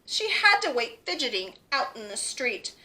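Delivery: ordinary delivery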